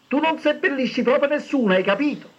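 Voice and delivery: a mother scolding from the kitchen, shouting high. A narrator's reading. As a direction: yell it across the house at your son. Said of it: "gli gridò la madre dalla cucina".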